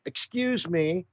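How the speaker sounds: high, polite mock call out a car window; politely calling to a beaver, Canadian style; polite Canadian yelling. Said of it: "yelling out the window".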